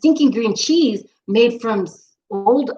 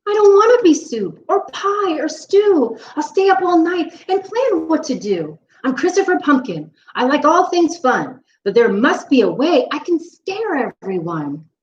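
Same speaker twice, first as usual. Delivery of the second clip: higher, fretful pumpkin voice; worried pumpkin; 4 semitones higher than usual. Acted. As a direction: plead then resolve to find a way. Pumpkin monologue.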